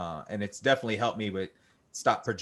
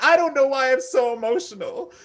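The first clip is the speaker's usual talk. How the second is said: much higher, louder mock-tearful whine; mock emotional; 11 dB louder than usual. Gym guy mocking himself crying mid-set, tearful whine. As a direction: ham up the teary gym breakdown. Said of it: "You know, I'm like".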